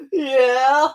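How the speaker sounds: high, loud fake sob; hammed-up tearful yes, half laughing; mock crying. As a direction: blubber it in mock grief. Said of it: "walking out of the theater crying".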